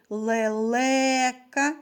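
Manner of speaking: "лелека" with the second е held long; fourth sound stressed. Shown as a demonstration. Teacher asking children for the next sound, drawing out the second е of "лелека". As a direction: stretch the second vowel е in "лелека". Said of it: "Наступний звук у слові".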